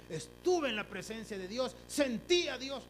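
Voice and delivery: loud, boastful show-off voice; mock boasting. Mocking a churchgoer who brags about feeling God, loud boast. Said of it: "llenarse la boca diciendo".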